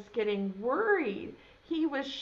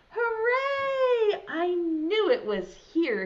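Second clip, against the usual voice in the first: high, loud happy cheer; 7 semitones higher, 4 dB louder, slower than usual. Acted. Newton cheering when he finds his boot. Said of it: "he cried".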